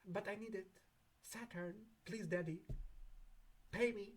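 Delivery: begging client. Pretend. Client begging Saturn daddy, soft mock pleading. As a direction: plead softly, wheedling. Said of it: "and you're like".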